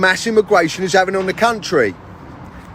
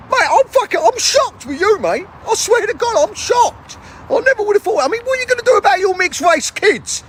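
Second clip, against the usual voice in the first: much higher pitched, aghast mate; shocked bloke; 12 semitones higher than usual. Acted. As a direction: play the stunned mate up high. Second character, shocked mate.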